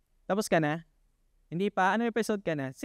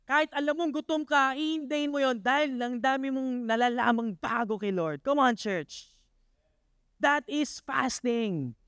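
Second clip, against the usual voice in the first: higher, driving sermon voice; preaching; 5 semitones higher than usual. Acted. Preacher urging the church to fast, fervent. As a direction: preach it with conviction.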